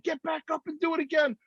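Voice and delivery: high-pitched coaching call; retold high urging. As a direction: order a fallen rider up, voice pitched high. Retold coaching of fallen rider.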